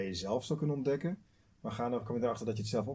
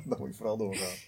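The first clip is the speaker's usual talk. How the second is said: laughing; 8 dB louder than usual. Real, not acted. Laughing at friend's advice.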